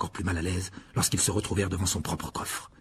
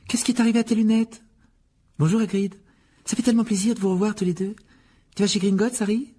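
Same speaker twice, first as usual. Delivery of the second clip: high-pitched, delighted schoolgirl voice; eager girl; 11 semitones higher, 3 dB louder than usual. Acted. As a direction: greet old friends in a high girlish voice. Hermione greets Harry and Hagrid. (voix aiguë de fillette enjouée)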